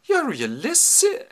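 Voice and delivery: high, loud, drawn-out squawk; shouted astonishment. Acted. Old nurse recognizing the scar, astonished shout. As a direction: cry out in slow disbelief. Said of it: "I'll give you something to shout over".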